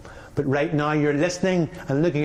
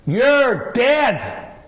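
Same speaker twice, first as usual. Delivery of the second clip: high, loud yelled threat; shout; 10 semitones higher, 6 dB louder than usual. Retold or imitated. Passenger in first class yelling over and over, high loud shout. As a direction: bellow it across the cabin. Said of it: "starts to shout".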